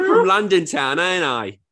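high, loud Cockney put-on; hammed-up London geezer accent; Del Boy. Answering a dare to sound Southern, mock Cockney. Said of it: "sound like fucking Del Boy".

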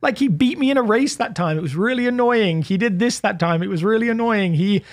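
put-upon brother's complaint; mock exasperation. Brother complains about always living with that guy, mock exasperated.

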